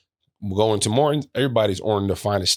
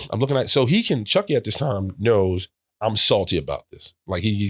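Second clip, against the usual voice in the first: usual speaking voice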